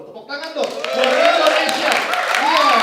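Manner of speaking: loud, high, drawn-out rallying cry; hype shout to crowd. Real, not acted. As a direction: whip the crowd into applause. Calls audience to applaud. (teriakan heboh mengajak tepuk tangan)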